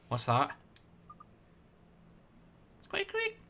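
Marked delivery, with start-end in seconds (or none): 2.8-3.4 mouse squeak. Retold or imitated. Imitating a mouse.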